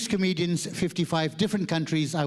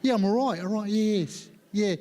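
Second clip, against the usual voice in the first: hammed-up mock British accent; 3 semitones higher than usual. Malaysian adapting on meeting a British person, hammed-up British accent. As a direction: overdo a British accent while agreeing eagerly. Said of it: "suddenly we meet a British person".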